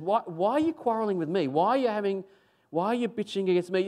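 mild indignant protest. Retold or imitated. Preacher as Moses answering the complainers, mildly indignant. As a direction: push back at accusers, pitch lifted. Acted Moses challenging his accusers.

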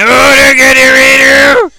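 high, loud sing-song yell; excited shout. Acted. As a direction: belt it out with glee. Excited at the screen.

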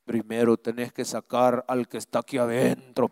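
low demon-like voice, lightly imitated; demonic voice. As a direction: growl it low like the thing inside. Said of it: "pero con una voz".

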